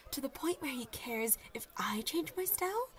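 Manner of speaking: normal talking voice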